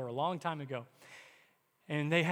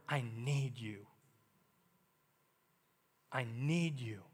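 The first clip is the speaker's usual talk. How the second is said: humble prayer; 6 semitones lower, 3 dB quieter, slower than usual. Acted. Prayer spoken in humility.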